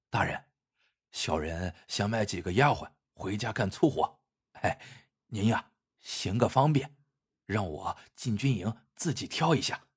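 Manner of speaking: low, softened, confidential murmur; hushed request. A narrator's reading. Zhang Da quietly asks the officer for a favor, hushed. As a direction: ask a favor discreetly, voice kept down. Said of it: "嘴里悄声说道".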